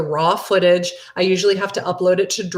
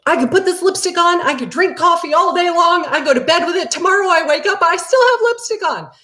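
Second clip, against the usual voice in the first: high, fast, raving about the lipstick; excited retelling; 11 semitones higher, 3 dB louder than usual. Retold or imitated. She retells raving to everybody about her new lipstick, excited. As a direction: gush like a thrilled fan. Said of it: "I told everybody I knew".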